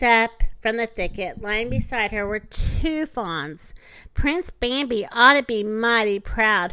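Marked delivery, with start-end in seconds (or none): none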